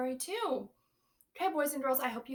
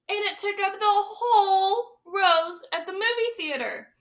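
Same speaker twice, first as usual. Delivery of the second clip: put-on lively read-aloud for young children; storybook reading; 4 semitones higher, 8 dB louder than usual. A narrator's reading. Teacher reading a picture book to her class, lively storytelling. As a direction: read it big and bright to the class.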